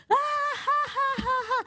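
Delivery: high put-on cackle; mocking laughter. The pranksters laughing at the recovering alcoholic, high cackle. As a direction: ham up two guys laughing their heads off.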